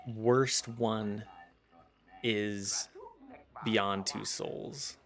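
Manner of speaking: very slow, lightly voiced Vader; Vader voice attempt. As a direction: drag the words out like a half-hearted Sith lord. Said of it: "Explain the plot ... in Vader voice".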